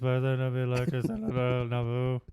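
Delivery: fast, droning mock recital; exaggerated monotone queen impression; monotone imitation. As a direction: run the words together on one pitch. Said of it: "It's very monotone".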